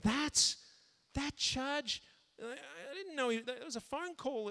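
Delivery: high-pitched mock disbelief; incredulous. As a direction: act aghast at the charge, voice way up. Acting shock at itemized bill.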